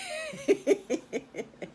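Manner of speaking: storyteller laughing at the rhyming names; high laugh. Real, not acted. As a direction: laugh along for real.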